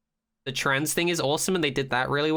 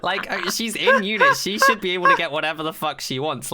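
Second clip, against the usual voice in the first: laughing; 5 semitones higher, 5 dB louder than usual. Real, not acted. Excited rant after laughing.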